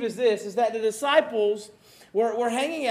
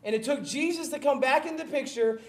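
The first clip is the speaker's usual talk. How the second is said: normal talking voice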